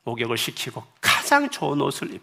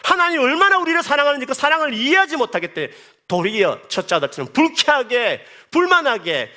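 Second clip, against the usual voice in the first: high, raised preaching voice; fervent preaching; 14 semitones higher, 6 dB louder than usual. Acted. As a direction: rebuke the judging believers. Preaching at full force. (높은 목소리로 꾸짖듯 설교하듯)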